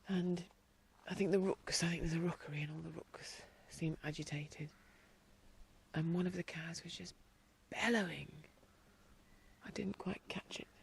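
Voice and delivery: hushed whisper so the animals aren't disturbed. Real, not acted. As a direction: keep it to a soft whisper. Whispering to not scare animals.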